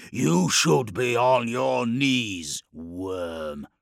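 slow, loud snarl dropping on the last word; monstrous snarl. A narrator's reading. Terminator ordering the captive to kneel, snarling. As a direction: snarl it with contempt. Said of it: "snarled one of the Terminators".

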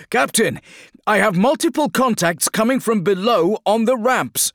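high, loud urgent report; shouting over battle. Acted. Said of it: "shouted Helicus against the crack and boom of battle".

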